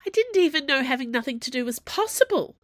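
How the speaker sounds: high-pitched wail of complaint. A narrator's reading. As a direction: wail it in despair at being bored. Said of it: "Finn wailed".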